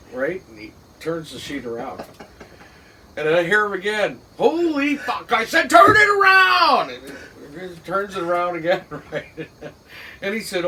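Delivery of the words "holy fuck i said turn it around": exasperated shout, pitched high; losing patience, yell it; frustrated yell. Retold or imitated. Boss yelling at nephew who keeps getting it wrong, furious shout.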